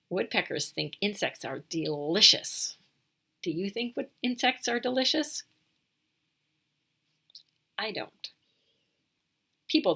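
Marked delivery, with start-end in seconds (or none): none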